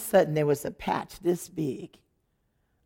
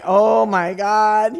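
slow, louder drawn-out prayer; praying gasp; 8 dB louder, much slower than usual. Retold or imitated. Wife praying in worry, slow and drawn out. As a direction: stretch it out like a desperate prayer. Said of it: "I'm like".

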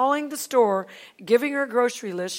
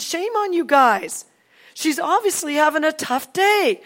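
raised, higher reproachful voice; retold chiding of friends; indignant scolding; 6 semitones higher, 5 dB louder than usual. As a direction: tell them off loudly, drawing it out. Retold scolding of her companions.

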